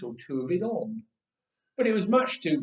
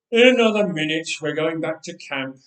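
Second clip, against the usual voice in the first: giant's voice; 9 dB louder than usual. Acted. Goliath to his shield bearer.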